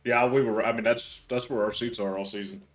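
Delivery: ordinary talk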